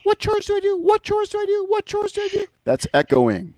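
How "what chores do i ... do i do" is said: much higher whiny mock repeating; mocking echo. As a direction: parrot it back in a squeaky high mock voice. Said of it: "So don't say".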